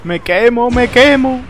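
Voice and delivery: high-pitched urgent calling; excited gamer outcry. Real, not acted. Gamer spotting a taxi while burning, excited outcry.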